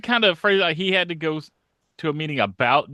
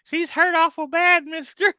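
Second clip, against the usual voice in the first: high character voice; 13 semitones higher than usual. Retold or imitated. Voicing a character line.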